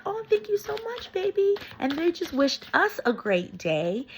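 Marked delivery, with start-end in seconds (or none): 0.1-1.6 very high, gushing sweet-talk; gushing thanks. Retold or imitated. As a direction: squeal your thanks, swelling louder on the last word. Acts out her gushing thanks.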